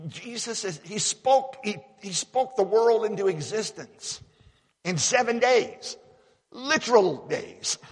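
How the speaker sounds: high, loud, rising preacher's fervor, slowing on the last words; preaching at full force. Acted. Preacher exhorting the church about creation, fervent and loud. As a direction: drive it home to the congregation.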